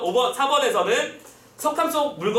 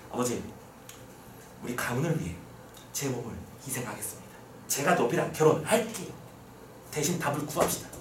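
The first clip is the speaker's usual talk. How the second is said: soft, low, solemn plea; acted quiet resignation, phrase by phrase with pauses; self-sacrificing daughter; 7 semitones lower, 8 dB quieter than usual. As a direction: play the dutiful daughter speaking low to her father. Daughter offers self-sacrifice to father.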